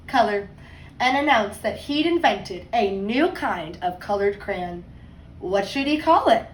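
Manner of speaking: put-on lively read-aloud voice for kids; story narration. A narrator's reading. Teacher reading a picture book to her class, storytime voice.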